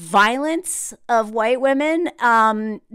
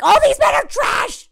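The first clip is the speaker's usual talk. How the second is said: much higher and louder outburst; exasperated shout; 6 dB louder than usual. Real, not acted. Vlogger venting at viewers, exasperated shout. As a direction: blurt it out fed up. Ranting about men on the show.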